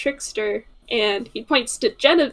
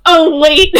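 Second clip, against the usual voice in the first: louder, a quick dawning surprise; sudden realization; 5 semitones higher, 11 dB louder than usual. Acted. Arata realizes his own mistake, loud surprise. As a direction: catch it mid-thought, louder.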